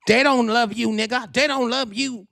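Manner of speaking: high-pitched cousinly warning; imitating cousin T T. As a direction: mimic a cousin's high, insistent voice. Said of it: "That's what T T told me".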